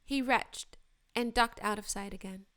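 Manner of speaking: plain reading voice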